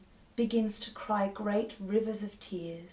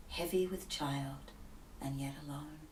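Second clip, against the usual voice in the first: softer and softer until whispered; fading to a whisper; 3 semitones lower, 7 dB quieter than usual. Acted. Enki repeating the image to the flies, fading whisper. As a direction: let it die into a whisper.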